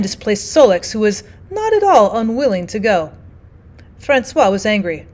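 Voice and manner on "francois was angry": ordinary delivery, nothing marked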